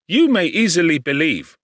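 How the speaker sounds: chatting brightly about his father; lightly voiced cheerful friend; Clerval, cheerful. Clerval tells his news.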